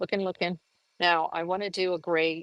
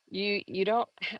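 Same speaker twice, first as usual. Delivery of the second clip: usual speaking voice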